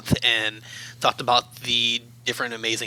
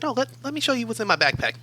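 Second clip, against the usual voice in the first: much higher and fast, pressing the point; insistent retort; 11 semitones higher, faster than usual. Retold or imitated. To the accuser, insisting he look in the backpack, high and fast. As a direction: push the offer, quick and pointed.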